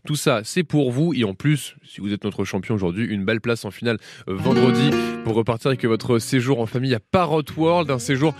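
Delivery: booming prize announcer. Acted. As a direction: sell the prizes with energy.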